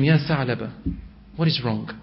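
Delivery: low, soft, tender question; gentle concern. Retold or imitated. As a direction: ask softly like comforting a sick child. Prophet gently asks the sick youth.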